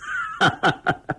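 high, hearty chuckle; rapid run of laughs; amused laugh. A narrator's reading. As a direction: burst into delighted laughter. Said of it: "丁伟乐了".

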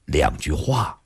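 low, resigned man's voice; sigh, then give in at a low pitch; long weary sigh. A narrator's reading. Li relenting to let him go, resigned sigh. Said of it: "李寻欢突然长长叹了口气".

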